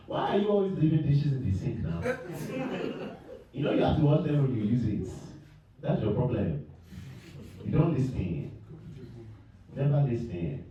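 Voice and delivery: a hint of scolding African parent. Parents scolding him about dishes, lightly voiced nagging. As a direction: nag like a parent who's had enough. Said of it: "my parents were like".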